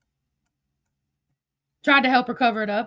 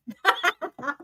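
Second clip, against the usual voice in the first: laugh; 4 dB quieter than usual. Real, not acted. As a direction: burst of real laughter. Laughs at imagined goodbye.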